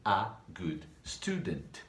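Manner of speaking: slow, clear repeat of the question; slow model question. Shown as a demonstration. Teacher repeating the question slowly for the class. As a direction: say it slowly so they can repeat.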